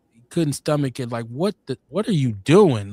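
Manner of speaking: ordinary talk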